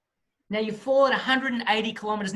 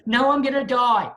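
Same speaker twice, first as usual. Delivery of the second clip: louder, higher panicky insistence; panicked protest; 3 semitones higher, 6 dB louder than usual. Retold or imitated. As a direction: blurt it out in mock panic. Panicking student argues with instructor.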